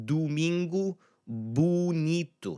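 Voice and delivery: high, slow "Domingo bonito" with doo and boo; doo-MEEN-goo, boo-NEE-too; unstressed O as U. Shown as a demonstration. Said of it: "kinda like a U sound".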